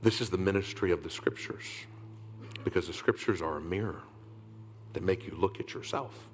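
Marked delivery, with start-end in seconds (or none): none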